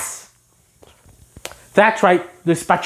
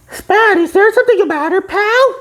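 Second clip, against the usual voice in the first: much higher, gentle cartoon voice; concerned SpongeBob; 14 semitones higher, 3 dB louder than usual. SpongeBob worriedly asking his spatula, high and gentle.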